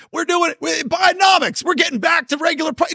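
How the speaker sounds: very high, squealed mock enthusiasm; mock cheerleading. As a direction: parody their excitement in a high-pitched cry. Mocks official Bidenomics boosterism.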